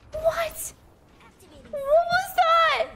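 high-pitched, startled outcry. Real, not acted. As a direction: shoot the pitch up in disbelief. Startled by something in the game.

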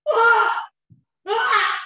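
loud, high sob-cry bursting out. Retold or imitated. Woman breaking down in a dressing room, loud high crying. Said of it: "I was trying not to cry".

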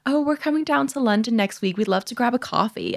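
high, put-on client voice; imitated international client. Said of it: "They're like".

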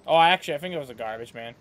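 ordinary talk, nothing marked

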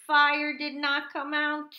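high, loud, slow narration; dramatic announcement. A narrator's reading. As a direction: declare the failure slowly and loudly. Dramatic failure before the reveal.

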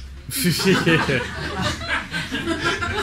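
long, high laughter. Real, not acted. Finishing the witch story, long laughter. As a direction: laugh through the punchline.

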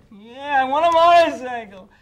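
high, loud nagging whine; whining kid. Retold or imitated. To Todd, insisting on the motorcycle, high whine. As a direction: insist like a spoiled child. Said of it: "whining kid going".

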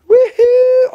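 high, loud woohoo; excited cheer. Real, not acted. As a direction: whoop with joy. Cheering about no show costs.

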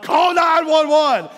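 yelling for help, high and slow; panicked shout. Retold or imitated. Pastor yelling to his family about the gas leak, panicked shout.

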